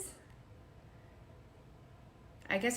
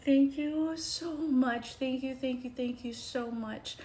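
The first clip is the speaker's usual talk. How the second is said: overjoyed thanks; 7 semitones higher, 5 dB louder than usual. Retold or imitated. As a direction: gush with gratitude. Thanking for acceptance news.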